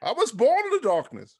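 Bane impression. Quotes Bane's movie line.

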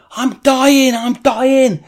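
high, loud re-enacted scream; imitated screaming. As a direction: scream it out like the wounded man. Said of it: "And he's screaming".

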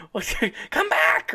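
put-on high, far old lady holler; grandma yelling. Grandma calling her grandson back home. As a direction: call after him like a cranky grandma. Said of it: "or if she yells".

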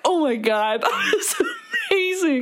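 high, squealing praise; mock gushing. Imitating a friend's praise, high laughing squeal. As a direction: gush over it, laughing. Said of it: "your reaction is like".

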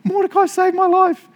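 high-pitched mock astonishment; king's shocked realisation. King who has just heard Mordecai saved his life, shocked. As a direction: gasp it out as a flustered, high-voiced king. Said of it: "So the king's literally just heard".

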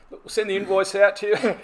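nervously laughing it off; a laughing lawyer ducks the price; mock awkward lawyer. Lawyers dodging price talk.

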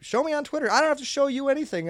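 high, loud mock demand; mock demanding customer. Customer demanding proof on Twitter, hammed-up high demand. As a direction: squeal it like the entitled customer.

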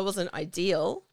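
ordinary talk

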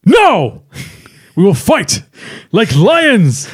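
play-acted defiant proclamation; defiant declaration. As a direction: throw it out like a mock-heroic battle cry. Acted defiant movie speech.

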